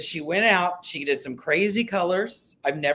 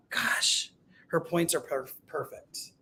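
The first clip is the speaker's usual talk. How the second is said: awed hush; 9 dB quieter, slower than usual. Real, not acted. Admiring quilt piecing closely.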